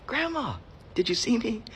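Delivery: put-on high, excited child voice; proud kid. Kid to grandma after reading, high and proud. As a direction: call out like a kid wanting to be noticed.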